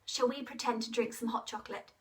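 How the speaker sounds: usual speaking voice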